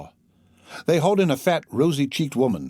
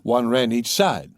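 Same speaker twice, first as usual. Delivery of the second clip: ordinary delivery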